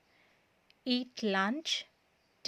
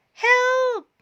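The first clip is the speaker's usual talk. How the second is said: high, loud cry for help; panicked high yell; 11 semitones higher, 9 dB louder than usual. A narrator's reading. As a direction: shout it in panic as the list flies off. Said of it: "cried Todd".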